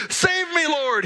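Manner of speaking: a touch of a panicked shout; retold cry for help. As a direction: call out like a man sinking. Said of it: "he shouted".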